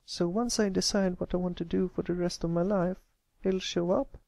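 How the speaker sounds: put-on a young filly asking anxiously; young filly Applebloom. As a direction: sound like a worried young pony. Applebloom hopeful.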